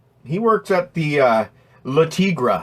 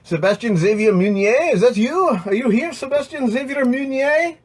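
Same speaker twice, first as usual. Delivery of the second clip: silly sing-song call; 12 semitones higher than usual. Pretend. Calling out to a chat viewer.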